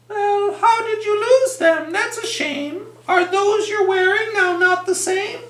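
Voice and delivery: high, bright-voiced peacocks asking; squeaky curious peacock voices; chirpy peacocks.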